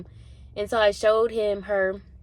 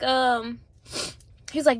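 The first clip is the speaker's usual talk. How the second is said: ordinary talk, nothing marked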